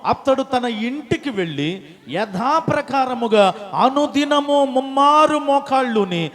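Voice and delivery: loud, slowing solemn reading; stately Bible reading; scripture reading. Acted. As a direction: read the verse out to the church. Scripture read aloud.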